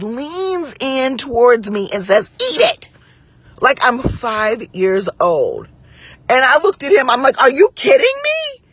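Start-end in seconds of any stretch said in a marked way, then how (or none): 2.3-2.9 sharp high command, pitched way up; mock bossy outburst, high and sudden; imitated raised-voice order. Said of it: "raising his voice at me". 7.4-8.6 high-pitched incredulous retort; outraged disbelief. Retold or imitated. Retold outraged reply to the manager.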